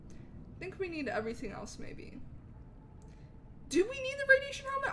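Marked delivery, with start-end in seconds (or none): 3.7-4.9 high, quick. Real, not acted. Sudden high quick question.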